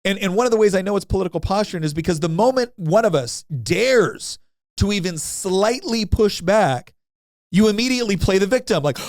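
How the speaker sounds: ordinary delivery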